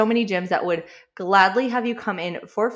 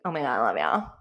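lower, laughing girly imitation; girl voice; 9 semitones lower, faster than usual. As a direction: mimic a gushing girl, laughing. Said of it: "in a girl voice".

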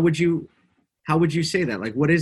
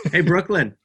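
calling out; 6 semitones higher, 4 dB louder than usual. Real, not acted. Calls daughter over from across room.

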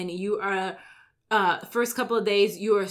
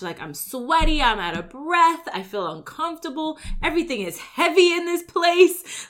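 whining, rising higher and higher; whiny complaint; 7 semitones higher than usual. Retold or imitated. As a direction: complain like it's unbearable. Miserable gym newcomer whining.

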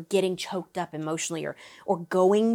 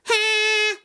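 very high, louder "Ha!"; high bright demo; 5 dB louder than usual. Shown as a demonstration. Vocal coach demos the high whiny sound. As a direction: pop the note way up high. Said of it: "you blend it with".

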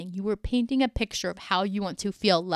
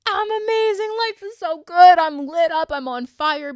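much higher and louder, bursting with joy; gushing excitement; 8 semitones higher, 9 dB louder than usual. Retold or imitated. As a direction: shout your joy like life is on fire. Imagined gushing about being happy.

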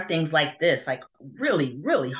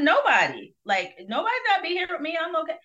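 mom brushing it off; 12 semitones higher, 5 dB louder, faster than usual. Retold or imitated. Mom brushes off daughter's worry.

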